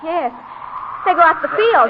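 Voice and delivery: worried daughter calling out while following tracks in the snow; urgent calling. Acted. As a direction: shout over the wind, worried.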